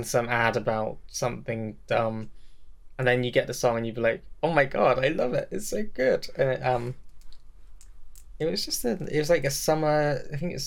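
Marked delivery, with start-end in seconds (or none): 4.4-6.3 retold delight. Fan recalling the song finally coming on, retold gushing. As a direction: pitch climbing higher with each phrase.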